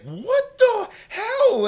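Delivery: high, slow, drawn-out what the hell; scared disbelief. Retold or imitated. Retelling the chase, a high slow frightened cry.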